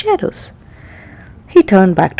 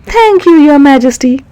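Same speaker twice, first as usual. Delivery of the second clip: much higher, louder grateful captain; proud thanks; 7 semitones higher, 8 dB louder than usual. Acted. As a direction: thank the king with pride. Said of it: "Barnacles accepted ... with pride".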